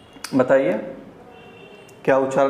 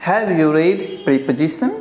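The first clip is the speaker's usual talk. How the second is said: slow, careful "Have you read preposition?"; slow example; much slower than usual. Shown as a demonstration. Teacher reads an example slowly. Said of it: "example sentence".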